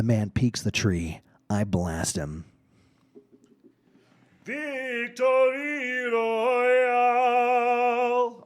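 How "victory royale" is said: very high, long-drawn victory yell; high, sustained winner's whoop; triumphant victory cry. Acted. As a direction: stretch each word out in a high triumphant cry. Acts out the winning moment.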